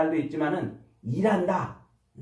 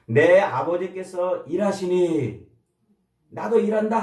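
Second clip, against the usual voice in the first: slow, louder proclamation of Jesus' words; solemn declaration; 4 dB louder than usual. Retold or imitated. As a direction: let each word land with weight.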